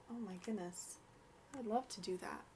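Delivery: ordinary delivery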